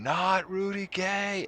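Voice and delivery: loud, high, drawn-out wail; imitated fan's scream, every word stretched; retold scream. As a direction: scream it slowly like a horrified fan. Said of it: "he's just screaming".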